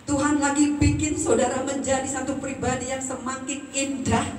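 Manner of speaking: high and loud, slowing on each semakin; rising sermon. Acted. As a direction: stretch out the promise, building fervor. Preaching building to climax.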